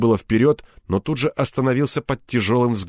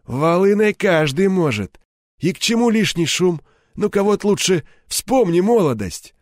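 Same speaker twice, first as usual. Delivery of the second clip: grey-haired gangster, goading; 9 semitones higher, 3 dB louder than usual. A narrator's reading. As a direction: needle him into doing it the old way. Реплики Седого. (голос седого бандита, подначивая)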